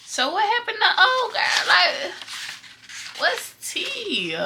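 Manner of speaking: giggling incredulous question; laughing disbelief. Real, not acted.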